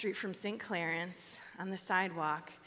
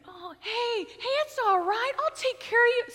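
put-on much higher, louder gentle young man's voice; compassionate young guy; 7 dB louder than usual. A sweet young guy comforting the fallen old man, higher and louder gentle voice. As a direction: voice a kind kid calming the stranger. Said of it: "oozing with compassion".

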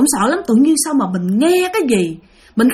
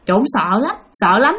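ordinary delivery

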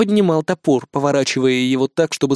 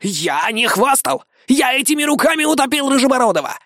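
lying boaster defending himself, pitched up; indignant braggart; 8 semitones higher than usual. A narrator's reading. Iggy denies bragging to the crowd, high insistent voice.

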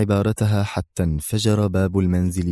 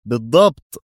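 louder, emphatic reply; emphatic confirmation; 3 semitones higher, 6 dB louder than usual. A narrator's reading. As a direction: confirm it with force.

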